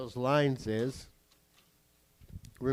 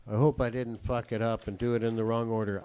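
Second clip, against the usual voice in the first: normal talking voice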